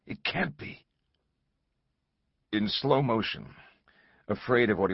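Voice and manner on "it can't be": breathless disbelief. A narrator's reading. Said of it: "his heart pounding".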